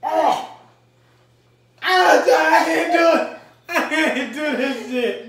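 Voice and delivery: loud cries from spicy heat; pained yelling. Real, not acted. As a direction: yell through the burn. Hot chip challenge pain.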